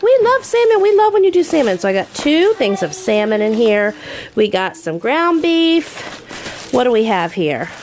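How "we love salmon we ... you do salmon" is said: put-on high, loud, eager kids' voice; excited kids. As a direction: gush like begging children. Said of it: "have been like".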